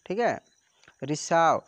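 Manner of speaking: ordinary delivery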